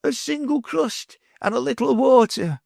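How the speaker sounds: beggar's plea. A narrator's reading. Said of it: "he repeated".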